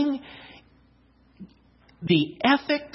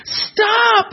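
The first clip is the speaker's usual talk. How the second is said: high, pleading shout to halt; acted high-pitched yell; 12 semitones higher, 3 dB louder than usual. A narrator's reading. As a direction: cry out as the dizzy clay begging him to stop. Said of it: "I shouted".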